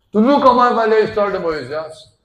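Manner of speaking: fervent sermon voice; forceful preaching. Acted. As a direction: proclaim it to the congregation. Preaching climax.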